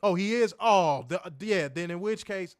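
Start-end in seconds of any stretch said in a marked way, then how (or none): none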